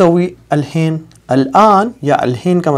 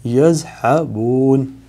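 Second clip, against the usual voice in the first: يذهبون drawn out very slowly, syllable by syllable; slow teaching read; much slower than usual. Shown as a demonstration. Teacher slowly sounding out the word for students. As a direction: stretch يذهبون out for learners.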